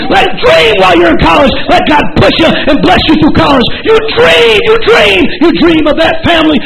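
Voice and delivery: shouted fervent preaching with high calls; rally them with every line; preaching at full force. Acted. Preacher urging young people to dream big, fervent high shouting.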